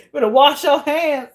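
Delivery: high-pitched, cracking up while scolding; laughing. Real, not acted. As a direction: say it through helpless cackles. Cracking up at own joke.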